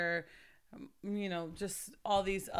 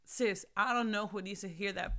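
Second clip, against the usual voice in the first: ordinary talk, nothing marked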